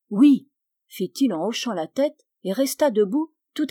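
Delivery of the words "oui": oui affirmatif. A narrator's reading. Boy answers in narrated dialogue.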